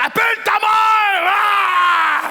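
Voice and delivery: high, loud dismissive yell; macho shout. Retold or imitated. Husband dismissing his wife, loud shout. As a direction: brush the wife off with a roar. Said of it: "l'homme, là, que quand sa femme".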